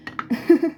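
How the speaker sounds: quick high giggle; a bright self-amused laugh; brief real giggle; short laugh. Real, not acted. After her banana hypothesis.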